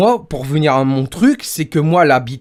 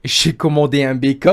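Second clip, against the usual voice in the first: ordinary talk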